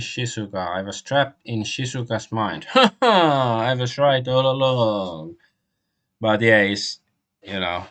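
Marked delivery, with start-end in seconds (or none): 2.7-3.6 loud, high burst of triumphant laughter. Real, not acted. Triumphant at having guessed right. 3.6-5.4 triumphant. Real, not acted. Triumphant at having guessed right.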